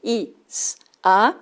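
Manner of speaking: i, s, a said one at a time; isolated sounds. Shown as a demonstration. Teacher splitting tiza into sounds. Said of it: "Sonidos t".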